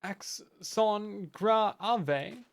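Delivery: deliberately bad French accent, slow and drawn out. Streamer mangles a word in bad French accent. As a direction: butcher it in a French accent on purpose. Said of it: "that was my best French".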